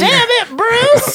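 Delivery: very high, loud mock-lady yell; hammed-up gruff woman, breaking into laughter. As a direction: screech it as a joke lady voice. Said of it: "like a gruff lady voice".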